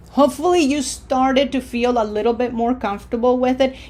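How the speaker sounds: usual speaking voice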